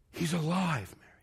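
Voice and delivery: soft, low, hushed news; tender near-murmur, acted; gentle revelation. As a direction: quietly break wonderful news. Revealing news gently to Mary.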